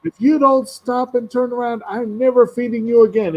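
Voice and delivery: high, fussy scolding voice; scolding Didymus. Retold or imitated. Didymus scolding his fleeing dog, high and fussy. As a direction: threaten the runaway dog like a stern little knight. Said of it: "he's like".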